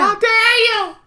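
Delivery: very high, loud hammed-up screech; mock outraged yell. Cohost joins the acted fight, mock outraged yell. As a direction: wail it in overblown indignation.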